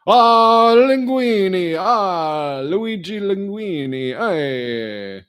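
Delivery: high, loud hammed-up Italian chef; mock Italian. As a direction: sing out Luigi Linguini like a cartoon Italian.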